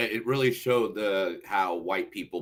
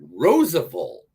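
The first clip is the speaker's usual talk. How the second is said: ad announcement; 7 semitones higher, 8 dB louder, slower than usual. Acted. Starts ad announcement.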